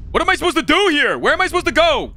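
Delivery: loud, high exasperated shouting; high-pitched confused yelling at the game; frustrated yell. Real, not acted. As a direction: yell it out, lost and fed up.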